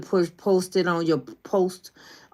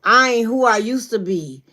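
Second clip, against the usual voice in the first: high, loud, slow and drawn-out proclamation; sister with more oomph; 6 semitones higher, 4 dB louder, slower than usual. Retold or imitated. Imitating little sister's new self, loud and proud with oomph. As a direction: declare it proudly, stretching the big words. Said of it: "now she got a little more ump to it".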